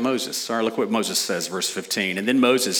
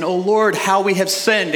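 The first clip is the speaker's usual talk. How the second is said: higher, louder mock cry of repentance; 8 semitones higher, 4 dB louder than usual. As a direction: wail the confession a little theatrically. Mimicking repentant Israel again.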